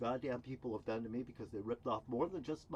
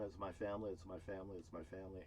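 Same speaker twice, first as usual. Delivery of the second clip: fading from soft to much softer, lower each time; pleading repetition; 5 semitones lower, 9 dB quieter, faster than usual. Retold or imitated. Vlogger quotes people pleading over their family, fading away. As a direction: let each repeat sink quieter, like a lament. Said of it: "and they're saying".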